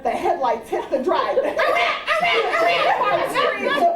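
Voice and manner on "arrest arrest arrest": high, loud repeated alarm; hammed-up frantic warning; alarm call. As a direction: sound the alarm to the sisters. Alarm as headlights hit drive.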